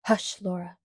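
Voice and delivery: mildly stern hush. A narrator's reading. Pa gently but firmly hushes Laura, lightly stern. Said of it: "Pa told her sternly".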